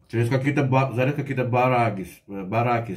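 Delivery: speaking with food in mouth; talking while chewing. Real, not acted. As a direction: mouth partly full. Seen 3: Bite taken at -1.0; talks with working mouth, fork in hand.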